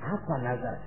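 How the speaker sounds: low, very soft question; puzzled query. Acted. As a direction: murmur it as an unsure question. Puzzled Ibrahim asks about vow. (bertanya lirih, bingung)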